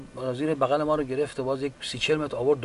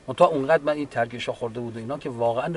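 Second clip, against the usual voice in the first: ordinary delivery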